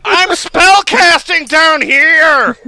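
high, loud, slow crazed shout; mock crazy yell. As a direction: bellow it like a madwoman from the basement. Imitating the crazy yelling neighbor.

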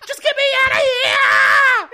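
high, loud desperate cry; desperate shout. Acted. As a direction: yell it out, begging to escape. Character cries out to escape.

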